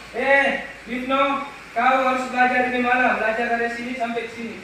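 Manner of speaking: loud, high call of a nagging mother; mama yelling. Retold or imitated. Teacher imitating a mother shouting at Ditno to study. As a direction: yell it across the house like mum. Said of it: "mama teriak".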